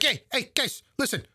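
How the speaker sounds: hammed-up New York pitch; New York accent. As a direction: rattle it off like a pushy New Yorker. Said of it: "doing his typical New York".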